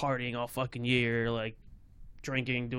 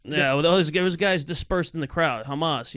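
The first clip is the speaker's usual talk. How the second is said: ordinary talk, nothing marked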